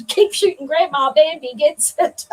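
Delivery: jokey gangster demand, half laughing; mock hostage threat. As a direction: play the hammed-up hostage taker through giggles. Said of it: "held the Nerf gun to Bambi".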